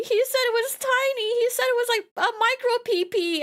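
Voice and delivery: high, whiny plea; mock pleading. Real, not acted. Pleading to viewers in a high mock whine. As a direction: beg high and indignant. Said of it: "I'm offended".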